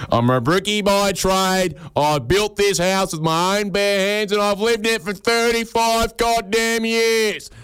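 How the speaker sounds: high, slow, drawn-out defensive boast; mock aggressive, stretched-out bluster; defensive homeowner. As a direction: defend your home like a proud old builder. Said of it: "very defensive".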